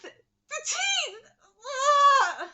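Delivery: high, loud cry of the teeth; emphatic shout. Real, not acted. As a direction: yell The teeth! in outrage.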